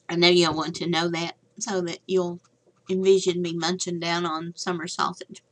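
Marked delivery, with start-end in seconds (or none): none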